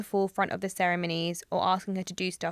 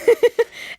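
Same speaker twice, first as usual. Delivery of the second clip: loud laugh; 11 dB louder than usual. Real, not acted. Bursts out laughing.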